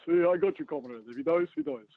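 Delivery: hammed-up mock Russian accent; Drago accent. Guest joking with the co-host as comrade, mock Russian accent. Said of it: "Can't get a fucking accent right".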